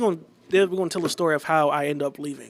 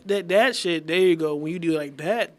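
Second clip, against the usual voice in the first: normal talking voice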